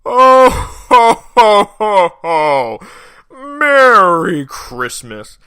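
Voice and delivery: booming ho-ho-ho, then drawn-out jolly greeting; big, loud festive voice easing into slow praise; Santa. Acted.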